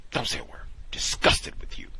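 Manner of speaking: hissed whisper, no voice at all. Retold or imitated. Instructor hushing the student, harsh whisper. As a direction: lean in and whisper it sharply. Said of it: "hissed aside to student".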